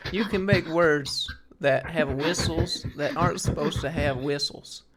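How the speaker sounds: slow molasses Georgia accent with a whistle through the bottom teeth; a slowed Savannah Southern accent with whistling sibilants. Shown as a demonstration. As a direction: drawl it slowly, letting the s whistle past the teeth. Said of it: "slow molasses with that little bit of the whistle".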